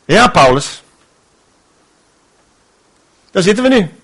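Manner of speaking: a hint of a complaining Silas, fast in places; grumbling Silas. Silas in the prison cell blaming Paul, lightly voiced grumbling. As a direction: nag Paul like a sour companion. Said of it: "En dan zegt Silas dit".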